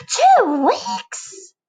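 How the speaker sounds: very high, loud disbelief; aghast at the long wait; squeaky outraged question; shocked caterpillar. Acted.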